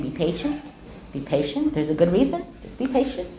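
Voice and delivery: low, hushed words to oneself; calm yourself under your breath; soft, restrained inner voice; quiet self-talk. Retold or imitated. Retold self-calming while annoyed in class.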